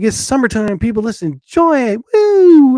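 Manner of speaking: mock party shout; excited cheer. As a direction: hype up the summer, ending in a big woo. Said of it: "And I'm like".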